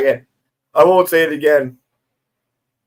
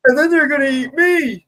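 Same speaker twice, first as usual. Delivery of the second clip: put-on panicked movie kid; 11 semitones higher than usual. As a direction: overact a horrified kid from a bad movie. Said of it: "When the kid was like".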